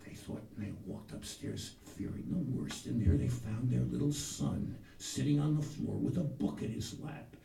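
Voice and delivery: normal talking voice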